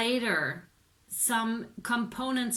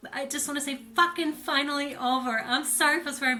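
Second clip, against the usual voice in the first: louder and higher, letting the relief explode; bursting with relief; 6 semitones higher, 5 dB louder, faster than usual. Real, not acted. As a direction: blurt it out, almost shouting with release. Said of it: "I really have that energy".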